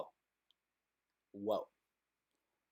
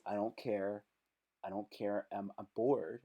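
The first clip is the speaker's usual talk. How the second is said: much lower and softer, barely bothered; bored low mumble; 10 semitones lower, 13 dB quieter than usual. Retold or imitated. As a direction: mutter it like you couldn't care less. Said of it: "that means".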